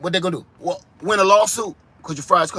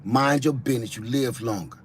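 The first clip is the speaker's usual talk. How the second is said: lower, softer gangster growl on "Mind your business"; gangster-ish; 8 semitones lower, 6 dB quieter than usual. Shown as a demonstration. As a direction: say the Toledo saying like a street tough. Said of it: "in that gangster-ish".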